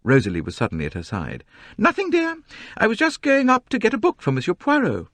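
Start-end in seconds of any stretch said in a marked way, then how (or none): 1.8-5.1 much higher, louder, self-important whine; high, complaining society novelist. A narrator's reading. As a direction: complain grandly about the crowd. Mrs Otterbourne to daughter.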